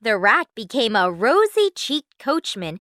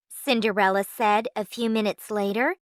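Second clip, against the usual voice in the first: ordinary delivery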